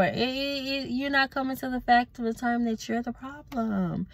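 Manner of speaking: usual speaking voice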